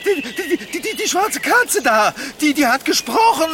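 stammering fright. Acted. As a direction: stammer out the horror of it. Man terrified by talking cat.

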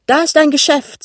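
high, loud cry of discovery; excited exclamation. A narrator's reading. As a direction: call it out on spotting it. Wife spots shop from moving car. (aufgeregt ausrufend)

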